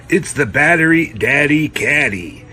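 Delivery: loud hyped product name; announcer voice. Pretend. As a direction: punch it like an ad.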